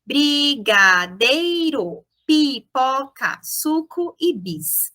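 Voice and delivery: slow, deliberate reading of a word list; slow list reading for the class; reading slowly. Shown as a demonstration. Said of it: "O que que tem aqui?".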